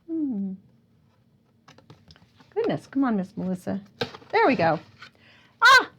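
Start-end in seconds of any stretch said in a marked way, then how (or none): none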